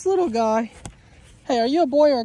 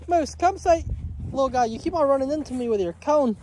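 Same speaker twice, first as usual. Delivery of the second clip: ordinary delivery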